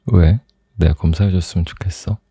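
ordinary talk

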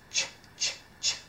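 "ch" as a soft unvoiced puff; ch sound alone. Shown as a demonstration. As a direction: give only the breath of "ch". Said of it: "C, H".

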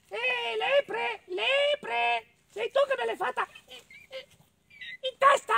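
very high squeaky mole calling out; little mole. Acted. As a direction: stutter the accusation in a tiny squeal. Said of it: "Così cosa fece la talpa?".

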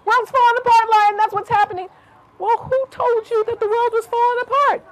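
high animal voice. A narrator's reading. Animals answer lion, high voice.

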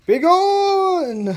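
high, loud ghostly cry; unearthly shout. Acted. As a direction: shriek it like a ghost. Ghost voice threatens visitors.

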